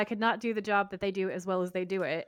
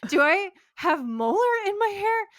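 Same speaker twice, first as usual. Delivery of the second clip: high, louder panicked whine; horrified squeal; 11 semitones higher, 5 dB louder than usual. Retold or imitated. Chuck finding a molar in his hair, panicked squeal. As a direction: freak out, voice shooting up. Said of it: "looks at it in horror".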